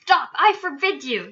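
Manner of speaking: urgent, alarmed command; panicked yell. A narrator's reading. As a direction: yell to cut him off. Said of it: "yelled Uncle Vernon in panic".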